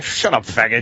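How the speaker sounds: low-pitched mock dismissal; younger Kevin Smith. Co-host imagining younger self scorning tears, mock dismissive. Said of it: "the younger Kevin Smith would be like".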